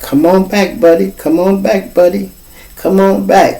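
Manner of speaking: lightly voiced cop pleading; coaxing cop. Police coaxing the boy awake.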